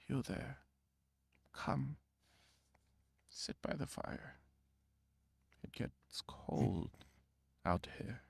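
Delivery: put-on raspy, hushed old stranger, partly whispered; a frail old man beckoning softly from the fire. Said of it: "the old man looks up and says".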